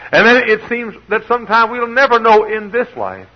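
high, loud pulpit voice; impassioned preaching. Acted. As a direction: lift it to the congregation. Preaching at full force.

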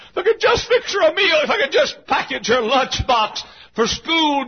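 high-pitched, anguished plea building to a loud cry; distraught little lady. Retold or imitated. Lady telling her pastor she can't care for her child, distraught cry. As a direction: plead like a heartbroken mother, swelling into a shout. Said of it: "Like that little lady said".

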